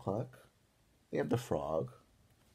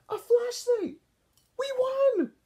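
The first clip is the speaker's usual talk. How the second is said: much higher, louder triumphant shout; excited cheer; 8 dB louder than usual. A narrator's reading. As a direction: burst out in victory. Kids cheer finding the flashlight.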